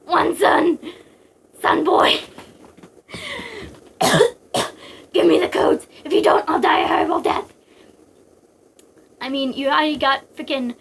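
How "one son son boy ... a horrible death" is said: desperate father. Acted. Acted dying father begging son.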